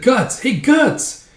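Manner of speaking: high, squeaky calling; insistent little elf; calling for attention. Acted. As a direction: pester him by calling his name. Puck tries getting attention.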